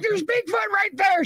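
high alarmed shout; mock alarmed shout. She retells shouting that Bigfoot is right there, high. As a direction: yell it out pointing in alarm. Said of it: "I'm like".

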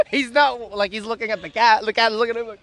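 usual speaking voice

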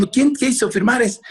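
high-pitched outraged demand; angry father. Retold or imitated. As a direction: imitate dad demanding answers. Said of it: "imagínate a mi padre enojado".

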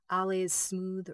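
ordinary delivery

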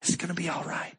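soothing father. Retold or imitated. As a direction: hush a frightened kid. Repeated comforting father line.

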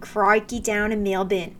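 slow, hammed-up Aussie catchphrase; mock Australian accent. Host jokes at Australian viewers' expense, exaggerated Aussie accent. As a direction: put on a cartoonish Australian accent. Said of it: "definitely offending all of our Australian viewers".

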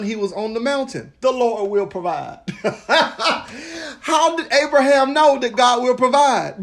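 high, loud, excited preaching with bursts of laughter; preaching at full force. Acted. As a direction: preach it like a revelation hitting you, laughing with joy.

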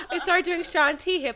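laughing while telling. Real, not acted. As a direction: laugh through the line. Laughs at own story.

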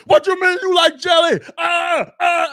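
hammed-up furious shout; zero to a hundred. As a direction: explode over nothing like a hothead. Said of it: "he'll go from zero to a hundred".

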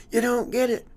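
high, loud retold holler. Former student chasing coach down the aisle, loud high holler. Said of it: "He kinda hollers and goes".

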